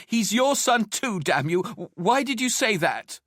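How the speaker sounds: loud, high, anguished reproach; angry outburst. Acted. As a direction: lash out in a high, upset voice. Wife's outburst in heated quarrel.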